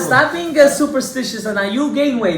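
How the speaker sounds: loud, high-pitched protest; emphatic debunking. Real, not acted. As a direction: scoff at it at the top of your voice. Argues with a listener's claim.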